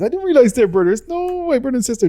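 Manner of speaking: high, amazed no way; surprised. Real, not acted. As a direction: realize it mid-sentence, pitch shooting up.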